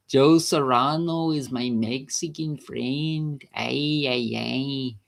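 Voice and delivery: slow, put-on Mexican accent. Shown as a demonstration. Showing off accents about a friend, slow Mexican accent. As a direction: draw it out in a mock Mexican accent. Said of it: "Mexican accent".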